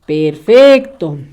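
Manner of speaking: normal talking voice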